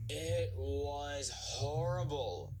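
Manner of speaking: slow, drawn-out moan; exhausted engine complaining. A narrator's reading. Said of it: "moaned Edward".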